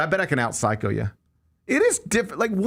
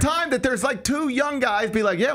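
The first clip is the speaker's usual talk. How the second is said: worked-up protest; 10 semitones higher than usual. Real, not acted. Defends himself in comic panic.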